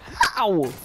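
startled yelp that cracks. Real, not acted. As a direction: let the voice break mid-word. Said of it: "that voice crack, though".

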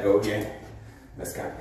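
usual speaking voice

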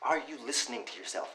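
low, shocked question to his friend; stunned quiet. Acted.

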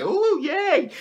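high squealing ooh yay; childish delight. Retold or imitated. Kid flipping through comic books, high excited squeal. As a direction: squeal like a thrilled little kid. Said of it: "and I'd be like".

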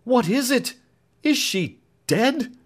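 high, loud, frantic question; a lover fearing the worst; desperate alarm. Acted. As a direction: ask in panic, slowing on the last words. Alfred in alarm, voiced high.

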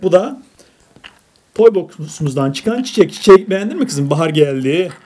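ordinary talk, nothing marked

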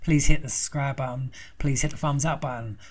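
ordinary talk, nothing marked

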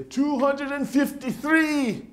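put-on high, slow boastful old man counting kills; father-in-law proudly reporting ants he killed. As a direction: brag the count like a trophy. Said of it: "he would say".

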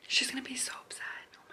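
hushed whisper while a call rings; barely audible aside; anxious whisper. Real, not acted. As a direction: whisper it under your breath.

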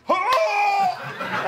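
high, loud laughing yelp of a cry; mock battle cry. Teammate diving in, yelping a mock hurrah. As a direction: belt out a silly hurrah mid-laugh. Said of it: "You just hear this".

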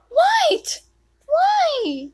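very high, loud disbelieving cry; shocked shriek. Real, not acted. Player shocked at being killed, shrieking. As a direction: shriek in outrage.

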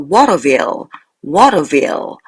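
Waterville said slowly and distinctly; careful model pronunciation. Shown as a demonstration. Teacher shows how to read the proper noun, careful model pronunciation. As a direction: model the name "Waterville" as one careful word. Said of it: "고유명사".

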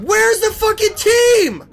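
loud, high, slow yell at the missing team; frustrated yell. Real, not acted. Gamer yelling at the absent team, furious shout. Teammates failing in a match.

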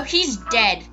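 normal talking voice

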